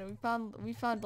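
usual speaking voice

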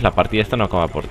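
normal talking voice

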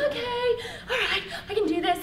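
high, nervous half-laughing squeak; nervous self-encouragement. Acted. Talking to herself in the mirror, nervously psyching up. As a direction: psych yourself up, pitched high.

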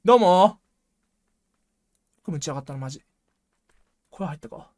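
ordinary delivery

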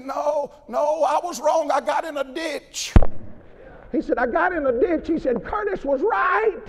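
high, pleading cry of a lightly voiced old man; hammed-up earnest confession; old preacher confessing.

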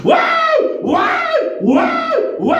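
repeated high-pitched mock shrieks; imitated possessed screaming. Preacher mimics women screaming in church, mock possessed shrieks. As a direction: shriek in short bursts, mocking possessed worshippers. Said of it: "gritar como endemoniadas".

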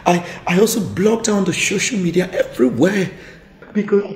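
loud, fast frantic explanation; rushed loud begging; loud desperate pleading. Acted. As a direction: plead loudly to keep her. Husband desperately begs forgiveness.

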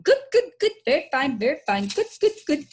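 high, loud birdlike hooting cries; bird-like crying. Acted. Billy flapping round the barn acting crazy, birdlike screeching. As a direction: squawk it out in high, punchy bursts.

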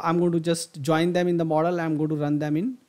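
usual speaking voice

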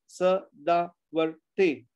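SADAVARTE very slowly in four beats: Sa, da, var, te; syllable by syllable. Shown as a demonstration. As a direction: count out Sadavarte with gaps. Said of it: "four sounds".

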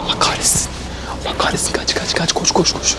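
frantic hushed whisper while fleeing; panicked whisper. Real, not acted. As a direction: whisper urgently, running and scared. Hiding from a guard in store.

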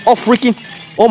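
ordinary delivery

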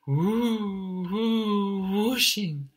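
"whoo, whoo, whooshing" with long, high swooping oo; stretched wind words. Acted. Wind blows round the chick, "whoo, whoo, whooshing" drawn out high. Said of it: "help you to extend the word out".

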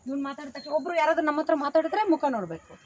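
ordinary talk

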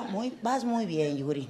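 low, soft, slow doctor voice; calm doctor. Retold or imitated. Comedian mimics her gynecologist, low, soft and calm. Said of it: "mi ginecólogo es así, como que bien tranquilo".

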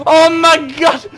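high, loud, slow cry of alarm; alarmed gamer wail; panicked shout. Pretend. Player attacked in the game.

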